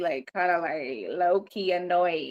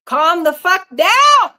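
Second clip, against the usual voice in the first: loud retort; 6 semitones higher, 12 dB louder, slower than usual. Retold or imitated. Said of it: "I told him".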